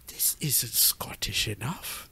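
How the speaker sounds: hushed, slow, breathy aside; a slow whispered grumble; whispered mock complaint. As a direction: mutter it under your breath, slowly. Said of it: "where's the Scottish anger? It's not here".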